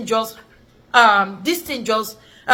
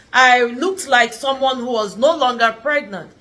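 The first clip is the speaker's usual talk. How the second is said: usual speaking voice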